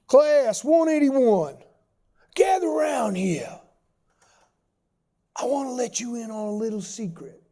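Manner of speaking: Southern instructor drawing recruits in, building to forceful punches; deep Southern accent. Retold or imitated. Old instructor tells seal recruits the price, in a Southern accent. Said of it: "deep Southern accent".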